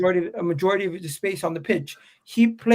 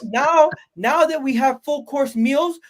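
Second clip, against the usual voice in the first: much higher, loud then slowing; emphatic raised voice; 7 semitones higher, 3 dB louder, slower than usual. Real, not acted. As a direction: push the point up high and slow. Heated argument, building point.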